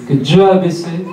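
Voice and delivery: ordinary talk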